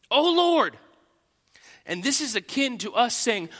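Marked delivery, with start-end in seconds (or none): 0.1-0.8 fervent cry. Acted. Preacher voicing David's cry.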